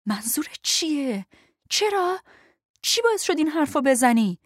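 rising shocked anger at the partner; words tumbling out in angry disbelief; angry outburst. A narrator's reading. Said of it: "پر از شک و عصبانیت بود".

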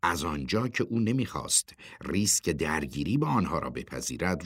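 usual speaking voice

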